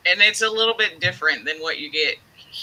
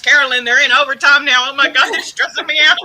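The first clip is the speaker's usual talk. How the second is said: loud, higher, frantic and laughing; mock stressed yell; 5 semitones higher, 9 dB louder than usual. To Carolyn during an overtime game, a loud frantic laughing yell. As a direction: yell it like overtime is killing you. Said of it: "sometimes I'll be like".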